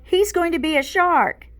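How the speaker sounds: yelled out. A narrator's reading. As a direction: shout it out over the class. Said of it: "Max yelled out".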